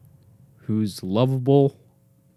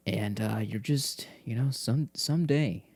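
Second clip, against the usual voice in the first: ordinary talk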